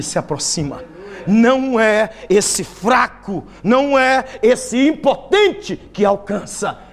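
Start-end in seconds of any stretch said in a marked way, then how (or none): 1.2-6.8 loud, slow sermon build; preaching at full force. Acted. Preacher thundering to the congregation, loud and slow.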